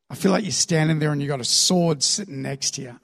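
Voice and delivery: ordinary delivery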